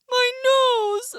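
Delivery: crying bear. Acted. Bear stung on the nose, crying wail. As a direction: wail harder in pain.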